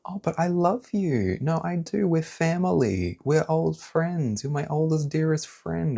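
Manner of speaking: high, sugary mock-loving voice; fake sweet voice. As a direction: imitate a falsely sweet friend. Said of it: "this type of fake energy where it's like".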